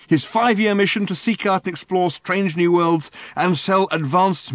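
high, loud, wound-up rant; ranting Ford. A narrator's reading. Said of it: "ranted Ford".

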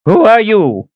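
put-on high, startled old man's voice; old Reuben. Frightened old man asking the stranger, high and sharp.

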